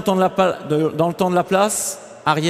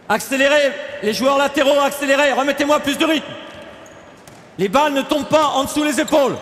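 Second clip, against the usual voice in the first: raised, urgent coaching call; 6 semitones higher than usual. Real, not acted. Coach to players in a passing drill, calling out. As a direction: call higher across the court to the players.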